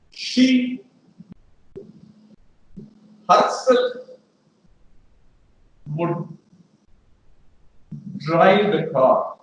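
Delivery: slow and deliberate, "herself" stressed; emphatic herself. Shown as a demonstration. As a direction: stretch out "She herself" for emphasis. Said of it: "To emphasize that".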